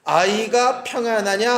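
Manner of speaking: slow, even reading of 아이가 평안하냐, rising at the end; slow scripture reading. Shown as a demonstration. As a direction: read 아이가 평안하냐 slowly as part of the verse. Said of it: "시작".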